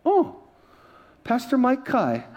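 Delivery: high little "oh"s, then slow name; mimicked recognition. Airline lady reading pastor's ID, mock high surprised recognition. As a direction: recognise the customer with dawning surprise. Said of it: "She looks".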